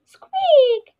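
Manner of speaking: very high, loud squeak; squeaky mouse voice. Shown as a demonstration. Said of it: "sound that mouse make is".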